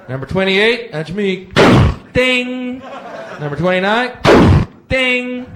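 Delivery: loud, eager calls of the numbers, ding shouted high; excited fighter calling numbers. Retold or imitated. Contender eagerly calling his number, shouting ding. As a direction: hype up each contender's turn.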